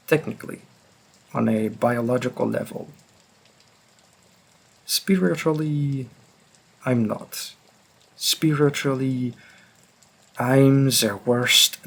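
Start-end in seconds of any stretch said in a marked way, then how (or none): none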